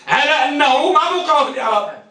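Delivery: ordinary delivery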